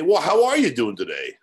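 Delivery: mock irritated retort. Imagined annoyed retort to caller.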